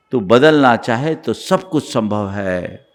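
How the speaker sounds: ordinary delivery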